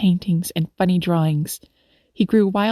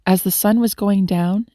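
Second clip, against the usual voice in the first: usual speaking voice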